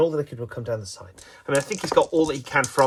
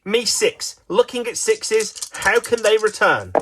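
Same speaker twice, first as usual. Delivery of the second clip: high, slow triumphant announcing; excited play-by-play; 8 semitones higher, slower than usual. Pretend. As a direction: savour each word like a thrilled commentator. Excited commentary after the big roll.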